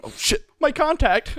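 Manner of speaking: high, drawn-out pretend alarm; jokey acted mishap; mock dismay. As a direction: cry out in hammed-up dismay. Acting out the eye popping off.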